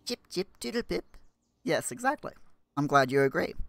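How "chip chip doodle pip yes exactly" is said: put-on high playful British accent; silly chirpy British accent. Mock British chatter continues.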